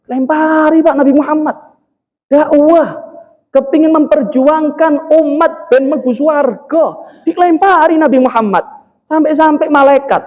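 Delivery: high, forceful sermon delivery; exhorting the congregation at a high pitch; impassioned preaching. Real, not acted. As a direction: drive each point home from the pulpit.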